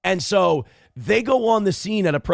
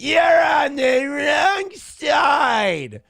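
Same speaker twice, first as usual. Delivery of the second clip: drawn-out, much higher mocking yell; mock shout; 11 semitones higher, 4 dB louder, much slower than usual. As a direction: howl it like an angry protester, very slow. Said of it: "And they're like".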